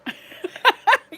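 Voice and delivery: high burst of laughter; loud laugh. Real, not acted. Laughs at joke.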